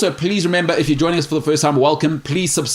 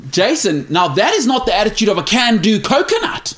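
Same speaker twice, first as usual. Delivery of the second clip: put-on New Zealand accent; 7 semitones higher than usual. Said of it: "oh, this is Jason".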